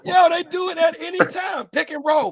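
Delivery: high excited yell of the punchline; hyped shout. Acted. Delivering the joke to laughing friends, hyped yell. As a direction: shout it like a sports call.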